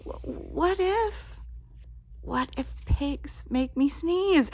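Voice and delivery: plain reading voice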